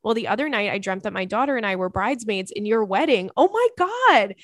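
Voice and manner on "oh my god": excited squeal. Real, not acted. Reacting to bridesmaid dream email.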